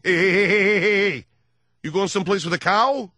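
lightly voiced tough cop; cop in a Western. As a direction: bark at a cowboy, lightly voiced. Dennis Franz Western role.

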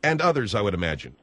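low, dry sarcastic aside. Real, not acted. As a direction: let the sarcasm sink into a low trailing finish. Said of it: "I detect the sarcasm in your voice".

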